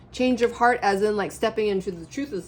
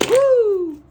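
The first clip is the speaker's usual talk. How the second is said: sudden high, loud whoop; excited whoop; 11 dB louder than usual. Real, not acted. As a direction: burst out in delight. Sudden excited exclamation at card.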